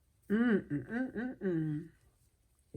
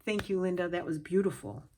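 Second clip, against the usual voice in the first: usual speaking voice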